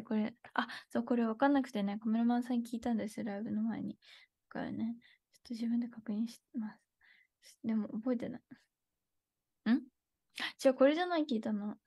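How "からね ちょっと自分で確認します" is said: hushed aside to herself; whisper. Real, not acted. 自分で確かめながら小声でささやく. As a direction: drop to a whisper while checking.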